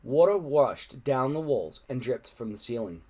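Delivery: usual speaking voice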